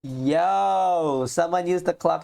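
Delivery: drawn-out, slow announcement; milk the shock slowly; slow dramatic reveal. Acted. Voicing shocking plot reveal.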